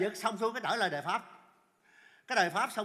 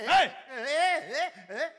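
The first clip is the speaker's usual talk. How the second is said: tiếng "ê" cao, to, dồn dập; kêu ré lên từng tiếng một; hét gọi hốt hoảng; 7 semitones higher, 8 dB louder, much slower than usual. Acted. Giật mình kêu trên xe ôm. (la hoảng, giọng hài)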